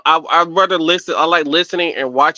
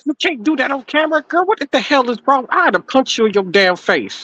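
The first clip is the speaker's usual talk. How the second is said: scolding; 8 semitones higher than usual. Real, not acted. Scolding on camera.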